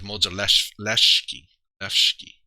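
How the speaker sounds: SH in place of S before the final kee of Modzelewski; almost-SH pronunciation. Shown as a demonstration. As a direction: say Modzelewski as "MOHD-zuh-LESH-kee", then repeat Leshki. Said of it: "it's almost like".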